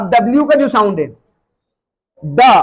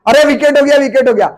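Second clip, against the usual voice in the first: excited cricket shout; 4 semitones higher than usual. Acted. Cricket fan calling out wicket.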